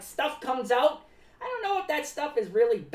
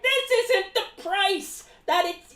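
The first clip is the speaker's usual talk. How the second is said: high, slow indignant complaint; hammed-up public outburst; mock public freak-out; 7 semitones higher, 4 dB louder, slower than usual. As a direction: imitate an outraged customer. Imitating people freaking out in public.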